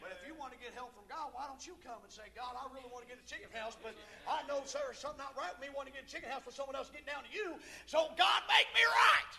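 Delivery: high, loud plea swelling into a shout; preaching building to shout. Acted. Preacher voicing a churchgoer's confession to God, preaching that builds to a shout.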